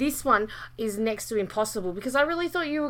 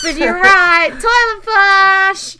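excited; 7 semitones higher, 10 dB louder, slower than usual. Real, not acted. Confirming correct quiz answer.

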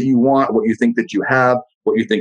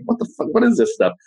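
a faint first line, then a baffled, much higher squeal; mock bafflement; 8 semitones higher than usual. Host imitating someone hearing simple advice, mock disbelief. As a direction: sound like broccoli just blew your mind.

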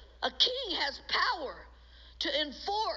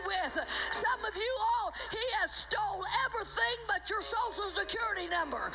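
higher, driving, emphatic sermon delivery; full-force preaching; 4 semitones higher than usual. Real, not acted. Preacher telling believers to stand up to the devil, forceful preaching. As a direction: drive it home to the congregation with authority.

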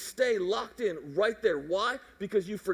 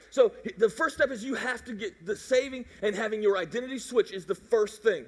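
ordinary delivery

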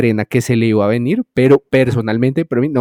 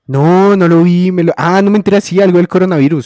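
high, loud burst of recognition; sudden recollection; 6 semitones higher, 6 dB louder than usual. Real, not acted. Co-host suddenly remembering the South Park special, raised excited voice. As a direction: deny it, then excitedly correct yourself.

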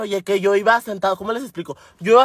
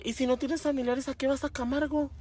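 high, soft puzzled lady; 5 semitones higher, 11 dB quieter than usual. Retold or imitated. Señora asking why go to Camargo, puzzled. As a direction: ask it higher and much softer.

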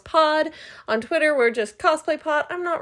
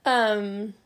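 ordinary delivery

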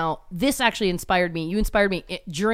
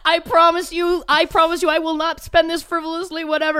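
higher-pitched mock-solemn promise; imitating earnest stranger; 7 semitones higher than usual. Homeless man promises driver about the twenty, imitated earnestly. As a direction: lightly play a man swearing an oath. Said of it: "he was like".

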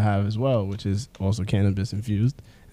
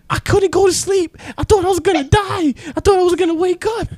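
very high, loud panicked mom voice; hammed-up mother reliving her paranoia; a mom telling her son how high she got, in a shrill mock voice; imitating mom; 5 dB louder than usual. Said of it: "She's like".

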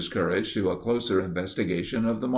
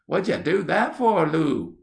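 high, whiny complaint through mock sobs; a child protesting, a touch tearful; sobbing complaint; 9 semitones higher, 4 dB louder than usual. A narrator's reading. Teddy complains to Lou between sobs, high and whiny. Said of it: "between his sobs, complained".

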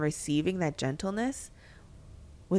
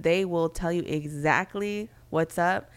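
ordinary talk, nothing marked

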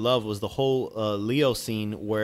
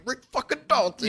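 much higher, cracking mock sob; tearful exclamation; 12 semitones higher than usual. Actor crying with pride, high mock sob. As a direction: squeal the name like he's about to cry. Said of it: "He's like".

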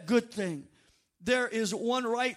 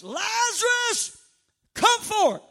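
high, loud commanding call, name stretched out; drawn-out preacher's shout of command; crying out loudly; 11 semitones higher, 8 dB louder, slower than usual. Acted. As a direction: call the dead man out at full voice. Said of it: "he cried out with a loud voice".

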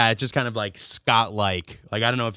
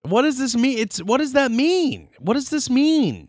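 very high, incredulous questioning; comically baffled, pitch shot way up; mock bewilderment; 14 semitones higher than usual. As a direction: demand an explanation in high-pitched disbelief. Baffled by an absurd name.

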